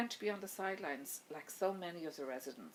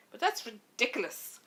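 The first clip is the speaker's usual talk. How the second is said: louder, higher burst of exasperation; husband, exasperated, louder at his wife's objection; 6 semitones higher, 9 dB louder than usual. Acted. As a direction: let the frustration spill out. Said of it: "Eduardo couldn't hide his exasperation".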